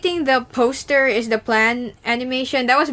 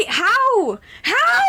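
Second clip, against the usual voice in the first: high disbelieving How? cried out twice; incredulous shouting, pitch soaring; baffled shout; 12 semitones higher than usual. Real, not acted. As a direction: yell it up in disbelief. Baffled by video's success.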